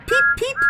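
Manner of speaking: very high, loud peeping whistle; whistled peep. A narrator's reading. Thomas whistling as they rush through the tunnel. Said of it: "Thomas whistled".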